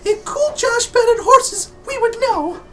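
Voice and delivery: very high, thin character voice; high squeaky character voice. Acted. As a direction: pitch way up for the character. Character voice in game scene.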